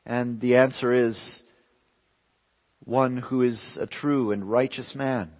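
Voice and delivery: usual speaking voice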